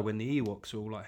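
normal talking voice

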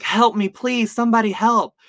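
high, loud cry for help; imitated scream. Abducted girl calling for rescue, imitated high scream. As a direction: act out a victim screaming for rescue. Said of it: "she was screaming".

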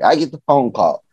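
ordinary delivery

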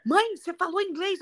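lightly acted startled son; shocked child. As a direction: catch mom out like a little boy. Son catching mother speaking English.

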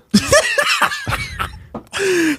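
squealing, breathless laughter; high shrieking laugh. Real, not acted. Laughing at the drawing.

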